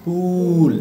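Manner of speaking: "পুল" with a long, held oo; long oo in "পুল", for contrast; long u sound. Shown as a demonstration. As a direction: stretch the vowel in "পুল". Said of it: "আর একটা হচ্ছে long".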